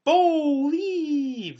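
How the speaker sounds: high, loud put-on wrestler voice; Bo Dallas catchphrase. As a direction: sell the catchphrase like Bo. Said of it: "he's just like".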